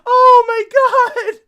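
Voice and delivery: loud helpless laughter breaking into the words; can barely get the words out for laughing; laughing to tears. Real, not acted. Streamer cracking up at a shocking comedy clip, laughing till near tears. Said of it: "I'm gonna cry!".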